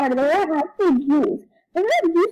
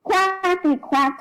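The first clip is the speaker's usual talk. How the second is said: slow quacking; much slower than usual. Acted. Duck character quacking.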